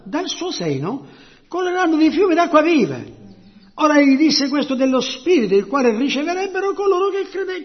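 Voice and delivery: high, ringing scripture proclamation; fervent scripture preaching. Real, not acted. Preacher reads scripture on the Spirit to the congregation, fervently. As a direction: proclaim the verse with rising fervour.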